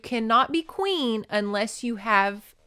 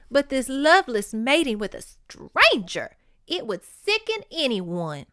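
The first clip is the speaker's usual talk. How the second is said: emphatic, slightly raised voice of a romance heroine; acted-out audiobook dialogue; dramatic story reading; 4 semitones higher, 4 dB louder than usual. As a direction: read the dialogue aloud with theatrical earnestness. Reads novel dialogue aloud.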